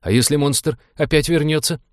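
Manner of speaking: high-pitched anxious lament; fearful wailing. A narrator's reading. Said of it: "запричитал Фрэнк".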